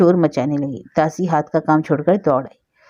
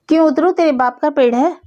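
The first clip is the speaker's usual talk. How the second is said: cheeky, higher and louder talk-back; defiant retort; 9 semitones higher, 4 dB louder than usual. Acted. Boy sasses the old woman from the tree, defiant. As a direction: throw the challenge right back at her.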